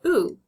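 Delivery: oo said alone, lips rounded; oo sound. Shown as a demonstration. Teacher showing the oo in to, lips rounded. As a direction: stretch out a round oo. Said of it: "the O's say oo".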